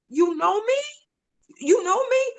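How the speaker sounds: high-pitched, wondering disbelief; amazed questioning. Retold or imitated. Wife finds her husband recognizes her, amazed questioning.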